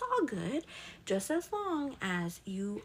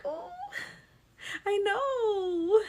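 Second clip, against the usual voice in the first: touched whimper; 12 semitones higher, 3 dB louder, much slower than usual. Retold or imitated. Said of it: "I'm like".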